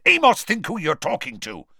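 loud, high angry rebuke. A narrator's reading. Mister Weasley rebuking Diggory, loud and angry. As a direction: snap back at him angrily. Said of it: "very angrily".

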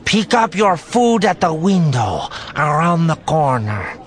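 high, loud creature voice; alien clerk. A narrator's reading. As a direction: growl directions as an alien cashier. Same Capoan clerk directing customer.

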